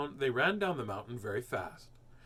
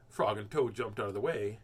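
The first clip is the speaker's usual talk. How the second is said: ordinary delivery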